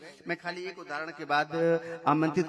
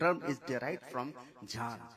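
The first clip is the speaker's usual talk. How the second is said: usual speaking voice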